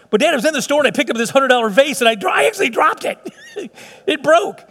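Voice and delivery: put-on high, fast, pleading child voice breaking into a yelp; whiny kid confessing. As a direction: play the guilty daughter, rising to a squeal. Daughter voiced confessing to dad.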